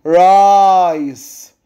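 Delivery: "رايس" with a long, drawn-out I; long vowel. Shown as a demonstration. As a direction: stretch the I sound in "رايس". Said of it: "بمد في الاي". (مد حرف الـ i في "رايس")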